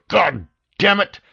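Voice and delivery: enraged director yelling; shout. A narrator's reading. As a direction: explode through the phone. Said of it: "the director shouted through the tiny speaker".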